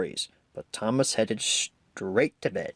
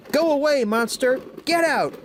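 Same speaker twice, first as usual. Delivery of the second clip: frightened order; 14 semitones higher than usual. A narrator's reading. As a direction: shout the monster away, eyes tight shut. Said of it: "Thomas ordered".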